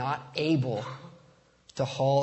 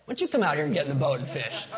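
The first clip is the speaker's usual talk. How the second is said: mock know-it-all advice; 6 semitones higher, 5 dB louder than usual. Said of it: "you're like".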